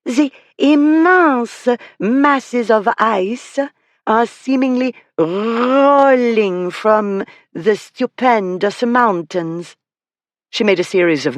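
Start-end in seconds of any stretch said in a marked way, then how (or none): 0.0-9.8 very slow, higher mock French accent with thick throaty R's; overdone French accent. Girl announcing the next view to the room, in an overdone French accent. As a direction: lay on the French like a stage guide. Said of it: "in an overdone French accent".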